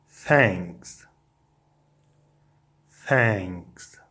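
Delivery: normal talking voice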